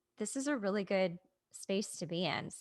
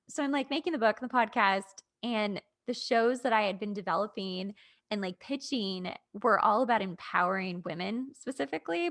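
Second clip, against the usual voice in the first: ordinary delivery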